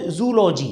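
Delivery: "जूलॉजी" as zoo-LO-jee; mispronunciation. Shown as a demonstration. Teacher guessing the learners' version, "जूलॉजी" as zoo-LO-jee. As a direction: run zoo straight into "lo" in "जूलॉजी". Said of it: "जैसे आप बोलेंगे शायद".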